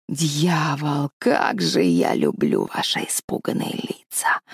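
very low, slow wheezing rasp; hoarse wheeze. Acted. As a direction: rasp it out deep and gleeful. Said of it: "прохрипела она".

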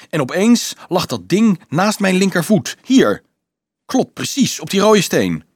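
high, animated, emphatic; excited recollection. A narrator's reading. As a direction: point out the exact spot excitedly. Excitedly reliving finding the box.

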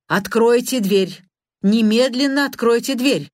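a hint of pounding at the door; shouting. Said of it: "кричал он". (громкий требовательный крик)

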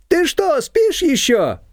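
high, slow, mock-outraged neighbour on the phone; indignant call. A narrator's reading. Shushana scolding sleepy Nina on the phone, high and indignant. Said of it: "возмущённо прокричала в трубку".

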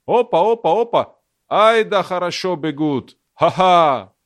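put-on jeering shout in an English accent; mocking English accent. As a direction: taunt the runners with an English accent. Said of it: "насмешливо орали с английским акцентом". (насмешливый крик с английским акцентом)

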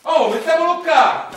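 high, loud eager yell; gleeful shout. Acted. Industrialist shouts to build on the Greek ruins. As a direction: shout it like a greedy developer.

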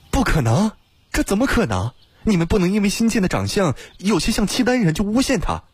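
high-pitched, incredulous objection; disbelieving protest. A narrator's reading. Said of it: "露出了难以置信的神情". (难以置信，高声辩驳)

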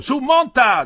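high, loud shout; calling out loud. Acted. Farmhand shouting to Metello to get on the cart, loud call. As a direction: yell for him to climb on.

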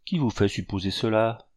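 plain reading voice

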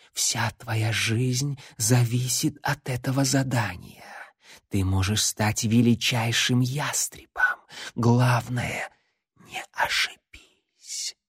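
slow eerie whispered prophecy; eldritch horror. Acted. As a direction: intone like an ancient darkness. Shadow gives the prophecy.

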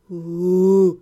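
deep pained groan from the half-gnome; low groan. A narrator's reading. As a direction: groan low and long, barely conscious. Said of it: "отозвался полугном".

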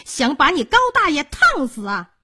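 stepmother's scolding rising in pitch and volume; angry cursing. A narrator's reading. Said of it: "骂道". (越骂越高的恶毒责骂)

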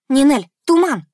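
sharp loud call across the arena; shout. A narrator's reading. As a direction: shout the command to a friend. Calling out to friend in battle. (громкий окрик)

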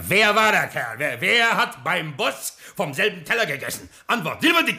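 hoch und laut gebellte Fragen; wütendes Verhör. Acted. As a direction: fordert drohend eine Antwort. (wütender Boss verhört seine Leute)

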